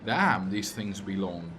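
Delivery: ordinary talk, nothing marked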